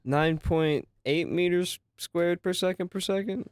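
high, slow, unsure question. Acted. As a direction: say it like you're not sure. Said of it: "why you gotta say like that".

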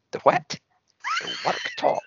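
high, disbelieving question; shocked disbelief at the word; incredulous. Retold or imitated. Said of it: "And I was like".

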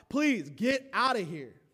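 slow, loud, high plea; begging plea. Acted. Egyptians begging the Israelites to leave, slow loud plea. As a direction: beg them to go.

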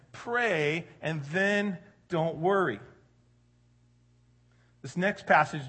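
ordinary talk

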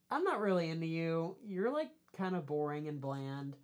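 loud, put-on brush-off; mock blunt rejection. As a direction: let them down bluntly, playing the part. Voices heroine's blunt rejection.

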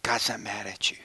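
soft unvoiced whisper; whispered reassurance to grieving grandmother; whispered comfort. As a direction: breathe it gently to her. Pastor comforting grieving woman.

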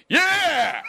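sudden high loud cheer from the back; lone cheer. Retold or imitated. Cheering for a name nobody else cheered, loud yell.

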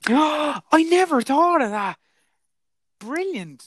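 high, drawn-out gasp of astonishment; mock amazement. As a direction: play up the sudden revelation, slow and very high. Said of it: "you're gonna go, "Oh my God".

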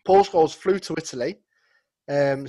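usual speaking voice

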